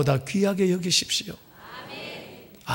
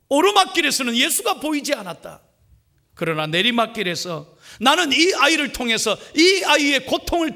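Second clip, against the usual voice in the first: raised, earnest preaching voice; heightened preaching; 6 semitones higher than usual. Acted. Pastor telling the congregation his turning point, raised preaching. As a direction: preach the testimony up high with feeling. Testimony preached with rising force.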